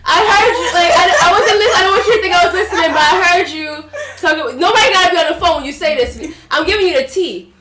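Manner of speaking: high, loud laughing auntie gossip. Retold or imitated. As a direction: spill the tea like a nosy aunt. Aunt voiced, gossiping, laughing.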